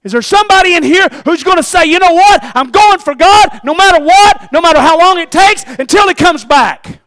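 loud, high preaching build; full-force sermon climax; fiery preaching. Acted. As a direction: preach it hard to the church.

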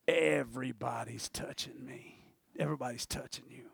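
low, soft, whispery delivery; hushed aside. Retold or imitated. Preacher acting Peter's remark on the crowd, hushed aside. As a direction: drop it to a near whisper.

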